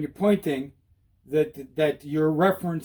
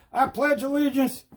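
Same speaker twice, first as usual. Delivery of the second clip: higher, measured recitation; mock pledge of allegiance; 4 semitones higher, slower than usual. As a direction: recite a joke pledge in chunks. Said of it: "What am I, pledging allegiance?".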